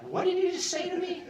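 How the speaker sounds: high disbelieving outburst; stunned, high-pitched challenge; incredulous. Retold or imitated. Inmate shocked at reply.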